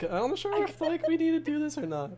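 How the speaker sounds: very high voice for a hesitant character. Retold or imitated. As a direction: imitate a waverer way up high. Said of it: "you're like".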